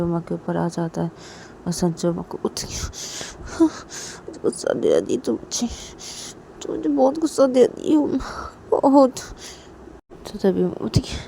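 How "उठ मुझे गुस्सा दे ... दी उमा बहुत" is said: higher, annoyed complaint; acted angry husband. A narrator's reading. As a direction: voice a husband who has been provoked. Husband's angry lines voiced in story. (हल्का गुस्सा, शिकायती लहजा)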